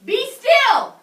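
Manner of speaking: high, loud order to be still; commanding shout. A narrator's reading. Teacher as Max ordering the wild things, shouting. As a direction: stop the monsters with one sharp command. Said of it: "Till Max said".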